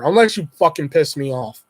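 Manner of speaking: ordinary delivery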